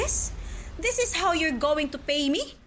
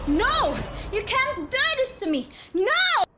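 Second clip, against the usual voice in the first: desperate protest; 8 semitones higher than usual. Acted. Exposed teacher crying out in desperate denial. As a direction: panic as it all falls apart.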